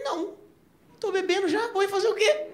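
high, strained voice. Retold or imitated. His own drunk reply, retold. (voz aguda, meio bêbado)

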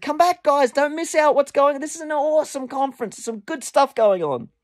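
high, urging call across a distance; calling them back. Retold or imitated. Calling the departing couple back.

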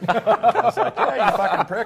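cracking up while telling him off; real laughter over a mock insult; laughing. Real, not acted. Retelling ribbing old instructor.

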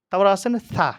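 normal talking voice

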